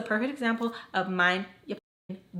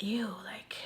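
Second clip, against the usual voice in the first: grossed out; 9 dB quieter than usual. Retold or imitated. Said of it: "really grossed out".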